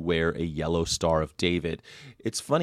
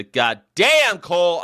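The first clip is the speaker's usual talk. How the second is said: drawn-out, louder goddamn; hearty exclamation; 8 semitones higher, 6 dB louder, slower than usual. Real, not acted. Host thanking Cole for his kindness, hearty exclamation. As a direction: belt it out in amazed thanks.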